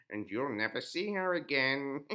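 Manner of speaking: loud, sinister crone; taunting the prince with glee; loud witch taunt; gloating witch. Acted. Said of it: "said the witch".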